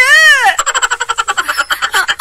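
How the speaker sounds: long loud high wail. Acted. Mother-in-law lets out a long high howl of pain.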